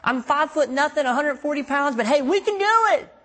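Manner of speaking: high, loud, eager plea to God; pleading with God like a hopeful kid; eager pep talk. Retold or imitated. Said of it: "But I was like".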